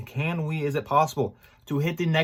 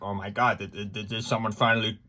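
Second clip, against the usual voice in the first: imitating excited hope; 6 semitones higher, 5 dB louder than usual. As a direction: act out his own hopeful excitement at finally getting a job call. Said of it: "I get excited 'cause I'm like".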